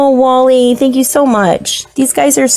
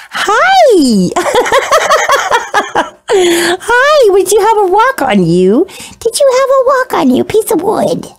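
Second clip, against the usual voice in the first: higher, giggly coo to a bug; 7 semitones higher than usual. Real, not acted. Greeting an isopod.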